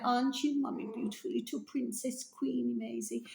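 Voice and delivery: soft, high praise for a little pet; fond cooing at a princess of a pet; doting pet talk. Real, not acted. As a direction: fuss over a tiny dog. Praises her pet Maisie.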